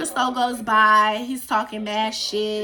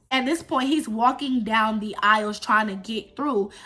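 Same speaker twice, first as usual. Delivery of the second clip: usual speaking voice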